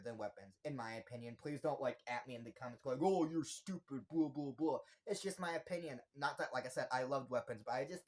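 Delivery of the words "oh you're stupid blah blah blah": drawn-out mock insult; mocking commenters. Imitating commenters who disagree about Weapons, mocking. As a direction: imitate a dismissive heckler, slowly.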